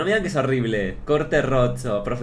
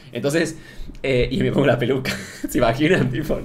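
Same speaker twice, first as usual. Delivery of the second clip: normal talking voice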